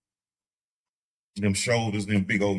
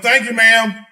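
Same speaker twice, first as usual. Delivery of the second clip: mock polite; 6 semitones higher, 9 dB louder, slower than usual. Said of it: "I was like".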